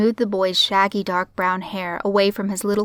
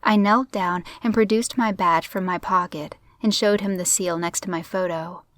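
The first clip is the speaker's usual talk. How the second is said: ordinary delivery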